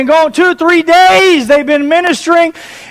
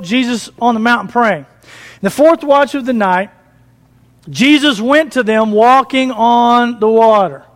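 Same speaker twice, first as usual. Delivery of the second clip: normal talking voice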